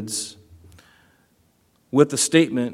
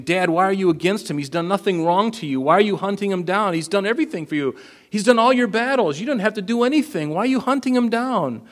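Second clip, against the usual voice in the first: rising, much higher plea to his father; pleading son; 7 semitones higher than usual. Retold or imitated. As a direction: beg Dad to spare David, climbing in pitch. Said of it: "Jonathan is basically pleading".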